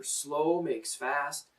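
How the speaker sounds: drawn-out saying; slow saying. Retold or imitated. Teacher quoting his first teacher, slow. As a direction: let each word land slowly. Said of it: "favorite saying of mine".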